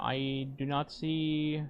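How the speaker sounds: ordinary talk, nothing marked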